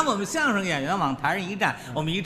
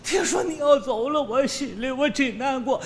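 mock tearful; 4 semitones higher than usual. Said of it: "拉着我的手直掉眼泪".

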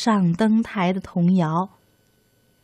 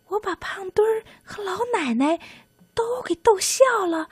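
little mouse voice; 10 semitones higher than usual. Acted. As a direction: muse happily in a tiny voice. Mouse thinks proudly.

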